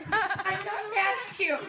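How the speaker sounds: high and slow, leaning on mad; slow drawn-out take. Shown as a demonstration. Director showing a slow, high Emily take. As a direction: stretch it out, pleading. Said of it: "They don't play her the same way".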